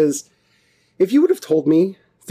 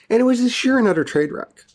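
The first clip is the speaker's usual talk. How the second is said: laughing; 11 semitones higher, faster than usual. Real, not acted. Laughing at first podcast.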